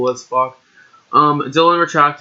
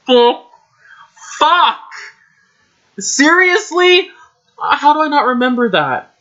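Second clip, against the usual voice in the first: shocked outburst; 11 semitones higher than usual. Real, not acted. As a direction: yelp in real shock at what you wrote. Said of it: "shocked at own story".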